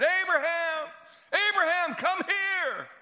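the father bellowing over his smashed business; furious scream. Acted. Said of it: "this blood-curdling scream".

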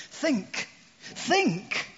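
high, rising emphatic think; pleading emphatic repetition; urgent plea. Acted. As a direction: press them hard to think. Writer urging readers.

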